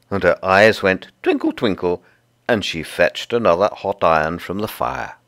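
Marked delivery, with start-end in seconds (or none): none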